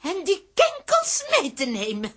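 hoge, luide spookstem die beveelt; spookachtige heks. Acted. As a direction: jaag ze als een spook het huis uit. Spook beveelt indringers weg. (hoge, dreigende spookstem)

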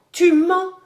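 high, loud accusation; horrified young woman crying out; appalled interruption. A narrator's reading. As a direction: cut in aghast, pitch leaping up. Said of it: "interrompit la jeune fille atterrée".

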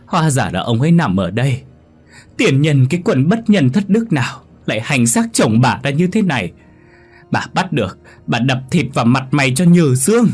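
high, loud grief-stricken wailing that swells into a howl; wailing lament. Acted. Wife grieving over what she thinks is her husband, loud wail. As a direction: wail and curse over the body. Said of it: "khóc lóc vật vã".

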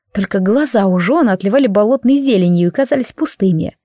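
ordinary delivery

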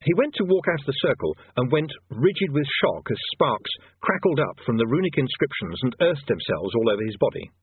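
ordinary delivery, nothing marked

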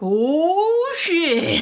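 high startled oh shit; shocked reaction. Retold or imitated. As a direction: blurt it out in shock. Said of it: "I'm like".

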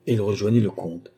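ordinary delivery, nothing marked

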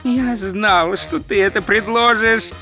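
high, plaintive moan; exasperated whine; groaned. Acted. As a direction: wail it in weary dismay. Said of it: "простонал Ринсвинд".

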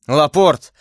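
ordinary delivery, nothing marked

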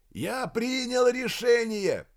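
high, drawn-out declaration; firm shouted decision. A narrator's reading. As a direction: declare it slowly, allowing no argument. Major shouts final decision. (твёрдо, высоким протяжным криком)

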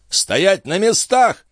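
high, drawn-out shouted command; shouted order. A narrator's reading. Stationmaster orders his grooms to stay put, shouted. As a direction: call it out high and slow to halt them. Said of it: "крикнул смотритель троицы конюхов".

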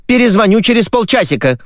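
put-on high-pitched TV girl's promise. TV girl promising to ring back, high voice. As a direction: sign off in a high, girlish caller's voice. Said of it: "пообещала теледевушка".

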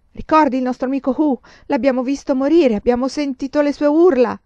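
high, loud, anguished testimony. A narrator's reading. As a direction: plead to be believed, pitched high. Piange ricordando l'amico ucciso. (tono accorato e straziato)

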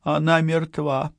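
high, slow, trembling words. A narrator's reading. Ausonio reporting a death to her partner, trembling voice. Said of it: "дрожащим голосом сказала Аусонио".

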